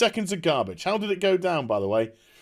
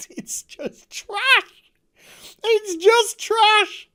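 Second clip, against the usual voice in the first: much higher, very slow squeaky disbelief; incredulous laughing; 3 dB louder, much slower than usual. Real, not acted. Reviewer laughing at the trashy scene, squeaking in disbelief. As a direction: stretch it out in giggling disbelief.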